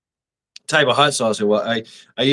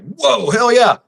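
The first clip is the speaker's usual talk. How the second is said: excited coworkers; 10 semitones higher, 4 dB louder, slower than usual. Retold or imitated. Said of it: "Those guys were like".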